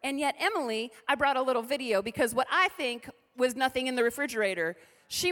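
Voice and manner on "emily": normal talking voice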